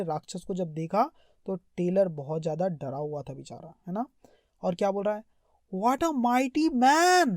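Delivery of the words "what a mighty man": slow awed reading. Shown as a demonstration. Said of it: "reading tailor's awe slowly".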